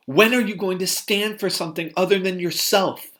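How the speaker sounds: woman accusing men of not caring, furious; furious retold rant. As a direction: hurl every question at them.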